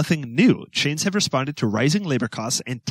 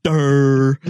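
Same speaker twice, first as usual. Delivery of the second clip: dumb grunt; 7 dB louder than usual. Pretend. Mocking dumb sound.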